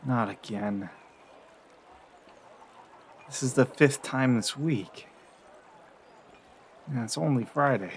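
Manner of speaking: soft, sighing, resigned; weary waking grumble. Acted. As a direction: grumble quietly to yourself after a bad dream. Character wakes from recurring dream.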